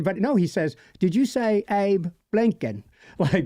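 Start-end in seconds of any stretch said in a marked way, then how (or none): 1.0-2.8 higher, slow English movie-quote delivery; film character's English accent. Acted. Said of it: "it was a totally different accent".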